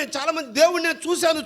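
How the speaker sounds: ordinary talk, nothing marked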